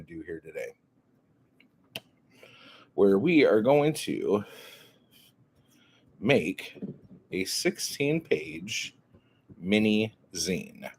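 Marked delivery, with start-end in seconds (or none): none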